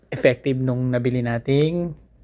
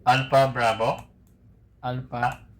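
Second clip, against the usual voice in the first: radio call; slower than usual. Shown as a demonstration. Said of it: "So, pakinggan nyo".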